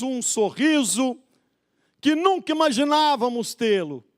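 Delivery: high, impassioned pulpit delivery; full-force preaching. Real, not acted. Preacher telling believers of God's miracles, full-force preaching.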